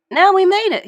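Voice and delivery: high, loud cheerful boast; pleased Patrick. A narrator's reading. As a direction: announce it like a genius. Patrick proud of his trick.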